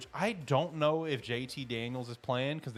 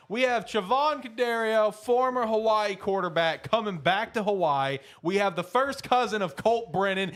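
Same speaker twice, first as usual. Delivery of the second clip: loud, higher, emphatic build-up; hyped-up pitch; 7 semitones higher, 5 dB louder than usual. Real, not acted. Host selling his pick to listeners, hyped-up and emphatic. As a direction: punch each reason like a hype man.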